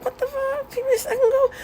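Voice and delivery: can't believe dad said yes, shrieking with joy; shocked excitement. Retold or imitated. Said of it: "I was so excited".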